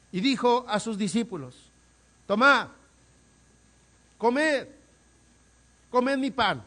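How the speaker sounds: loud, high, impassioned preaching; fervent preaching. Acted. Preacher pleading before his congregation, fervent and loud. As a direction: preach it out with full fire.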